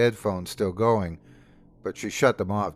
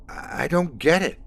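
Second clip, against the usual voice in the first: higher, exasperated, pleading to make sense of it; 7 semitones higher than usual. Acted. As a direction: push the frustration up a notch. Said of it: "growing more exasperated".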